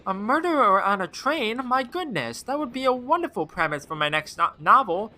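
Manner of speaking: put-on high, sweet old-lady voice. Novelist lady greeting the detective in a high sweet voice. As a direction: coo like a kindly grandmother.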